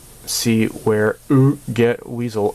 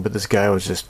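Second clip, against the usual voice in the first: normal talking voice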